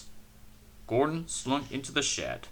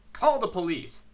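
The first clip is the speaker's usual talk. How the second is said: higher, urgent demand; angry shout; 6 semitones higher, 3 dB louder than usual. A narrator's reading. Passengers demand police, mild angry shout. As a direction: demand it angrily. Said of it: "they shouted angrily".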